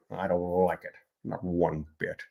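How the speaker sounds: Grinch impression. The Grinch sulking about Christmas for viewers. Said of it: "Jim Carrey Grinch impersonation".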